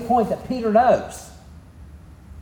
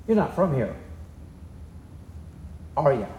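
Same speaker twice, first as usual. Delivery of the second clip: veiled warning; 5 semitones lower, 8 dB quieter than usual. Shown as a demonstration. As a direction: make the question a quiet threat to watch their step. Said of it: "Which means watch your step".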